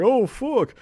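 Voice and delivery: sudden high yelp of shock; mock shocked curse. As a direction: squeal it, caught off guard. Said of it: "he's like".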